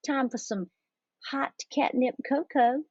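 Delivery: high-pitched mother's call; singsong calling out. A narrator's reading. Mama offers the kittens cocoa, calling out. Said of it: "called out Mama Junebug Jones".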